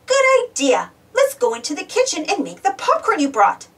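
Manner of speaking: ordinary delivery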